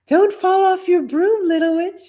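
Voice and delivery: high, tender lady's voice; fond farewell. Acted. Lady fondly calling after the girl, high and tender. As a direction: say it fondly like to a child. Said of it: "said one lady fondly".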